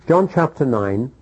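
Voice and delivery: ordinary talk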